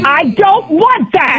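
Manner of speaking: high, slow, shouted protest; real angry outburst; furious refusal. Real, not acted. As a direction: shout each word in exasperation. Furious customer refusing the offer.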